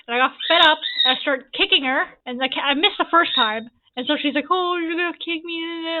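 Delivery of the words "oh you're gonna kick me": drawn-out, higher sing-song jeer; taunting singsong imitation; mocking taunt. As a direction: imitate a sister goading you, slowly. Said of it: "sitting there beaking at me".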